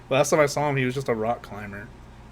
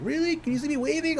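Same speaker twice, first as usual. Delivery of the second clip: high, eager hippie voice; imitating a hippie; 13 semitones higher than usual. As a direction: chirp it like an excited kid. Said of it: "he was like".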